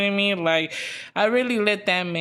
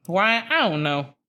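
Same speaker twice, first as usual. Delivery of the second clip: ordinary delivery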